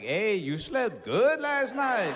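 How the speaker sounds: drawn-out sly remark from the mom; teasing girlfriend's mom, slow and high; knowing mom. Retold or imitated. Mom teasing next morning.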